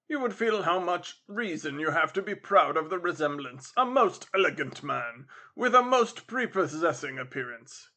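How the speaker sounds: lightly voiced captain paying compliments; flattering captain. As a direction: lay on the compliment with a touch of emphasis. Captain flatters Simon in story.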